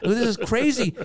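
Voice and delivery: high-pitched comic disbelief; mock freak-out at what was just agreed; retold panic. Comedian alone in hallway after agreeing, comic panic.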